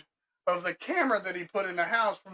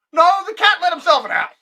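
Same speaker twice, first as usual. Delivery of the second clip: higher and louder, incredulous; retold reply to an angry customer, much higher and louder; exasperated retort; 10 semitones higher, 12 dB louder than usual. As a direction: fire it back in disbelief. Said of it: "And I'm like".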